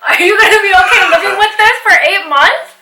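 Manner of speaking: laughing. Real, not acted. Teasing partner about aligners.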